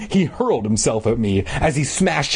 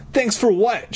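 high indignant question; demanding; 9 semitones higher than usual. A narrator's reading. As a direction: demand an answer in a higher girl's voice. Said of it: "she demanded".

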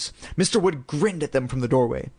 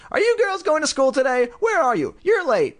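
mother calling; 12 semitones higher than usual. A narrator's reading. Mother calling up that the girls are late. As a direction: call up to the girls' room.

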